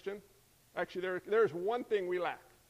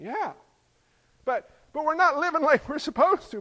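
Paul answering emphatically; 7 semitones higher, 7 dB louder than usual. Retold or imitated. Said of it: "Paul's saying".

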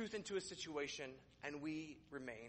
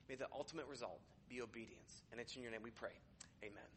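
soft, low, reverent prayer; hushed, unhurried talk to God; 5 semitones lower, 5 dB quieter than usual. Real, not acted. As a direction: pray quietly and sincerely, settling softer toward the amen. Leads an opening prayer.

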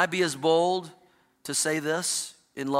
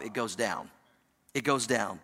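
quiet and low; 9 semitones lower, 7 dB quieter than usual. Acted. Pastor hushing his voice on "down". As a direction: sink the voice low and soft. Repeated point, dropped low.

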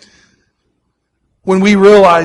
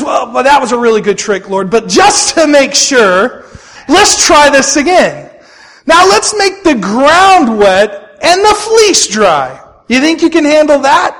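challenging Gideon; 7 semitones higher, 6 dB louder than usual. Acted. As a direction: test God with stretched, pitched-up words. Gideon challenges God to repeat trick.

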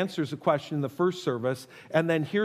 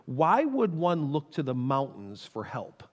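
usual speaking voice

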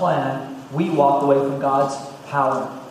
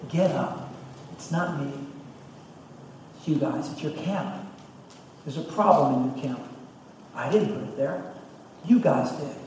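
quiet rebuke; 9 dB quieter than usual. Acted. God quietly telling Israel the problem is theirs. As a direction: tell them gently it's their fault. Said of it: "And God goes".

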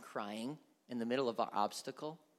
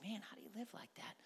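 whispered disbelief; 4 semitones higher, 12 dB quieter, faster than usual. Retold or imitated. Incredulous aside.

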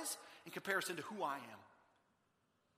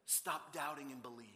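soft, low, calm appeal; gentle urging; 5 semitones lower, 7 dB quieter than usual. Acted. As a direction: quietly urge a friend to trust. Jesus gently tells Thomas.

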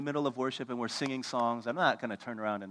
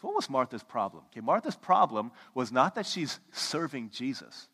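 usual speaking voice

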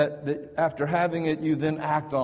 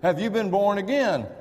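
higher, louder, pressing the question; pushy challenge; 7 semitones higher, 4 dB louder than usual. Retold or imitated. Tract man confronting the professor, pushy challenge. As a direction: demand it of a stranger at lunch. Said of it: "looked us in the eye and said".

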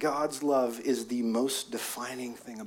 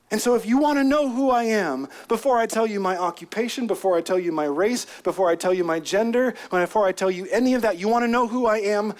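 raised, insistent preacher's voice; impassioned preaching; 7 semitones higher, 5 dB louder than usual. Acted. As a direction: drive each phrase home in a building list.

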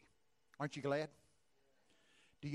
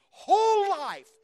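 booming; 18 dB louder, much slower than usual. Retold or imitated. God's promise builds to booming.

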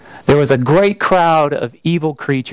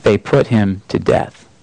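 ordinary talk

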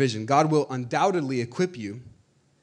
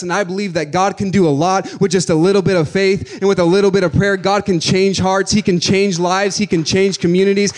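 louder, higher, slowing to hammer each line; rising pulpit fervour; preaching at full force; 4 semitones higher, 7 dB louder than usual. Acted. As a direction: preach it to the back row.

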